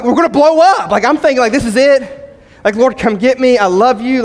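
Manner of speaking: comic mock terror; mock panic. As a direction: play the driver sure he's about to blow up.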